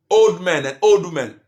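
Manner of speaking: ordinary talk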